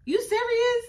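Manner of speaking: high squeaky disbelief; incredulous. Retold or imitated. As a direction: stretch it up in shock. Mocking disbelief at the fight.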